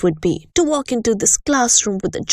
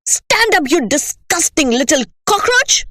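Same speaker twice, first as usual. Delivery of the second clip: much higher, louder command; angry order; 7 semitones higher, 3 dB louder than usual. Acted. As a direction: bark the order. Headmistress ordering pupil.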